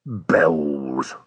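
very low, grumbled single word; scornful echo. Acted. Driver echoing the sailor's word to himself, scornfully. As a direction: drop the pitch deep and grumble it.

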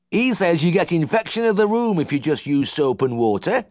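high twang, pleased with himself; contented old farmer. A narrator's reading. Uncle needling the vet about his methods, high twang. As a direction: smugly pass on the tip.

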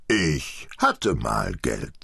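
slow, subdued admission; contrite. A narrator's reading. Old father answers his scolding family, contrite. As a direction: own up to it slowly, crestfallen. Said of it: "sagte ihr Vater zerknirscht".

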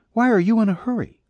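her voice. Acted. Woman's lines voiced higher.

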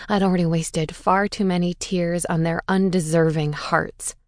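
ordinary delivery

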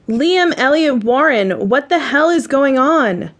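acted outrage without real shouting; angry yell. A narrator's reading. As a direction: scold with the full name, stretched out. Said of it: "I yell, storming through the room".

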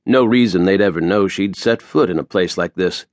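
ordinary delivery

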